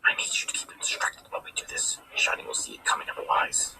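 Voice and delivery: conspiratorial whisper; hushed scheming whisper. Acted. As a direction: breathe it so the prince can't hear. Secret plan whispered to ally.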